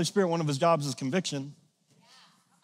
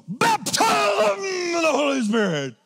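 high, slow, crying shout; mock faith healer; 10 semitones higher, slower than usual. Pastor mocking a forceful faith healer, hammed-up shout.

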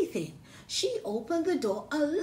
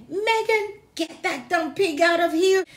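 teacher's voice; 7 semitones higher, 8 dB louder than usual. Acted. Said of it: "The teacher said".

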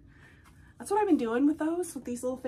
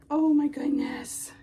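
hushed awe; 3 semitones higher, 6 dB louder, slower than usual. Real, not acted.

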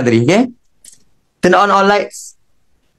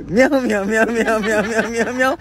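higher playful meowing, reading a cat's letter; mock cat meows; 4 semitones higher than usual. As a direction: meow like a cat writing to uncle. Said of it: "Uncle tu kucing".

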